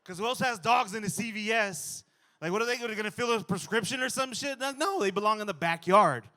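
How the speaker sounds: loud, high indignant riff; worked-up rant. Real, not acted. Comic ranting to the crowd about dog owners. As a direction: build the outrage at dog owners.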